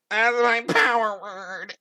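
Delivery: high, drawn-out frantic wail; panicked cry. Acted. Dennis before running away, a high panicked cry. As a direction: wail it slowly, high-pitched.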